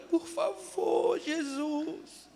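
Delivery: slow, pleading, rising to a cry; humble cry for help; sincere plea. Shown as a demonstration. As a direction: beg God honestly. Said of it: "falar assim".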